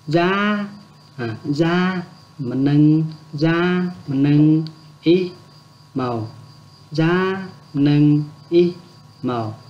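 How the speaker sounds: Gia nâng ý màu broken into single slow syllables; slow syllable drill. Shown as a demonstration. Teacher slowing down Gia nâng ý màu for learners, syllable by syllable. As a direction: spell it out one syllable at a time, slowly. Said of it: "Đọc chậm lại nhá".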